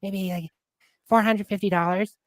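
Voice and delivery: lightly voiced hesitant old lady. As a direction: imitate an unsure elderly contestant. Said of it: "this old lady's like".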